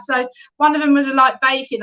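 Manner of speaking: ordinary delivery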